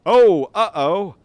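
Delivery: loud, high startled outburst; real jolt of alarm, voice leaping up. Real, not acted. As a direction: yelp it as trouble suddenly appears. Sudden danger in the game.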